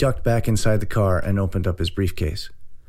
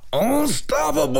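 drawn-out, high-pitched roar; bellow. A narrator's reading. Large man boasts to the girl filming him, bellowing. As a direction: bellow it, stretching the final vowel long. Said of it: "and bellowed".

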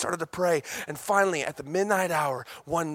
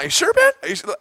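mock disbelief; 6 dB louder, faster than usual. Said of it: "I'm like".

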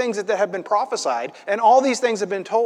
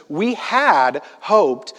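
slow and deliberate, "had" punched louder; stress on "had"; 5 dB louder, much slower than usual. Shown as a demonstration. Preacher rereading the key verse, stress on "had". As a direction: lean hard on "had" before "hoped". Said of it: "notice that key word, had".

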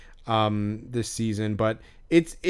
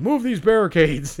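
raised-pitch imitation of an order; mock hot-headed demand; 5 semitones higher, 4 dB louder than usual. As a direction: mimic an irate man barging in. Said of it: "came out so fucking hot".

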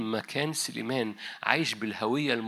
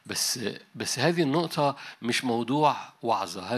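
ordinary talk, nothing marked

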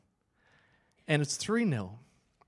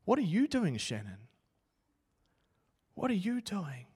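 booming voice of God; 3 semitones higher, 3 dB quieter than usual. Retold or imitated. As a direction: answer him back gently but firmly. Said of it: "this voice came booming into my helmet".

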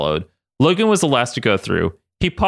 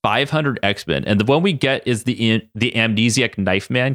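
plain reading voice

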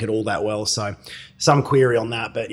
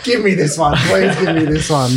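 higher, louder playful begging; pleading; 4 semitones higher, 4 dB louder, slower than usual. Real, not acted. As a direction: beg for it, half laughing. Begging to keep the win.

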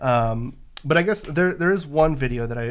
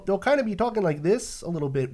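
higher-pitched nasal Yooper inflection; nasal Upper Midwestern accent; 6 semitones higher than usual. Shown as a demonstration. Showing co-host how Wisconsinites talk, nasal Midwestern accent. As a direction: talk through the nose like a Yooper. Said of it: "it's like a nasal inflection".

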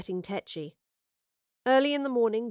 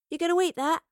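blunt, higher-pitched demand; 9 semitones higher, 4 dB louder, faster than usual. A narrator's reading. Girl to soldier over his stew, demanding. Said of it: "she demanded".

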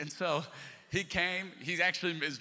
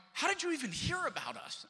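retold astonishment; 12 semitones higher than usual. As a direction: voice leaps high in disbelief. Acts out his astonished question.

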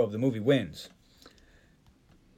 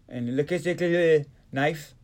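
ordinary talk, nothing marked

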